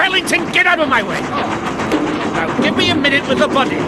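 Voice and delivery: ordering bystanders off the dangerous car; commanding shout. Acted. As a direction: take command over the noise. Ordering people back, shouting.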